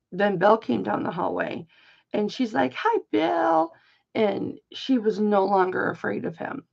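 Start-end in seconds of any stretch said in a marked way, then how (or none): 2.7-3.7 put-on high little-girl hello; imitating child's greeting. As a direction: lift it high like a friendly three-year-old. Granddaughter greets the spirit she sees.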